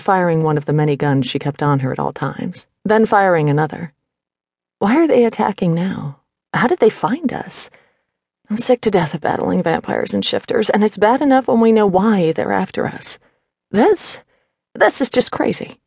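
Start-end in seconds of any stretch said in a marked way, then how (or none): 4.8-15.8 high-pitched, fed-up fighting heroine; frustrated woman rebel, voiced higher; exasperated Quinn. A narrator's reading. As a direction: vent exasperation mid-battle, pitch jumping up. Quinn's exasperated speech in battle.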